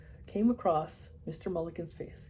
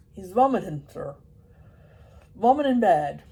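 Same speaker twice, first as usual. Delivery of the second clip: put-on loud Irish old man; Irish accent; 9 dB louder than usual. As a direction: answer like a deaf Irishman. Mulligan answers loudly.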